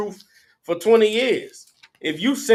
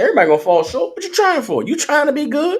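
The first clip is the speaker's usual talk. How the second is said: higher, louder hustler voice, taunting; mock a pimp sweet-talking a woman into the game; preacher imitating a pimp mocking a churchgoer, high and loud; 8 semitones higher, 5 dB louder than usual. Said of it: "that's how pimps talk to hoes".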